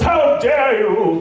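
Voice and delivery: drawn-out, high mock outrage; hammed-up indignant gasp of a line, very slow; offended diva. Precious actor rejecting a line read, mock-offended. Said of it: "you come off being like one of those".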